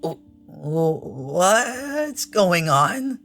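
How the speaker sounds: dazed bewilderment. Acted. Rider facing a strange dome, dazed bewilderment. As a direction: stretch it out, rising in dazed disbelief.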